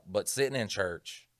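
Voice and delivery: usual speaking voice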